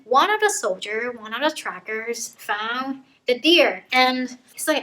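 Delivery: usual speaking voice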